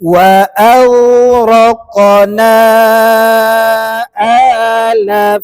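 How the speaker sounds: slow, drawn-out melodic tajwid chanting; Quran recitation. Acted. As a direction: chant each phrase slowly for the class to repeat. Recitation for repeating.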